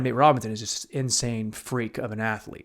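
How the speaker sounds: ordinary delivery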